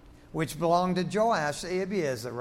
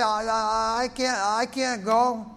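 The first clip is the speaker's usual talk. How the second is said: Moses; 4 semitones higher, 5 dB louder, slower than usual. Acted. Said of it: "I mean, he stuttered".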